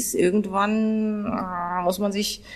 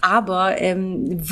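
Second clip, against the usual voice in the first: normal talking voice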